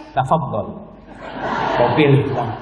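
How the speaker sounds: low, gentle acted invitation; serene obliging wife. Wife offering husband a choice of socks, serene. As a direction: offer it calmly, pitch well down.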